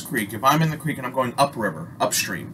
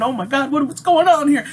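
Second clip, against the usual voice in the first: panicked; 12 semitones higher than usual. Retold or imitated. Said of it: "I'm fucking going".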